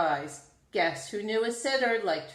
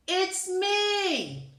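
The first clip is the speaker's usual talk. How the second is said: shouted; 10 semitones higher than usual. A narrator's reading. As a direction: call out cheerfully to announce yourself. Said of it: "he shouted".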